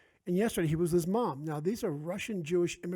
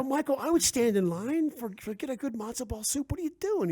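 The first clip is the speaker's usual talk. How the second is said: higher-pitched playful remonstrating; retold exasperated protest; 7 semitones higher than usual. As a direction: plead your case in mock exasperation. Retelling argument with future son-in-law.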